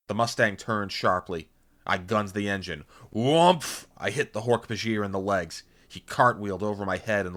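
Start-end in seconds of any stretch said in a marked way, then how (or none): 3.1-3.9 high, loud whumph; imitated impact. A narrator's reading. Narrator as car hits Hork-Bajir, loud imitated whump. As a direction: punch out a collision noise.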